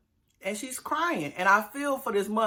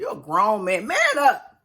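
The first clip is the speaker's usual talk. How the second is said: man up scold; 3 semitones higher, 5 dB louder than usual. Acted. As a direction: bark it at him like a scolding big sister. Scolding Clint on TV.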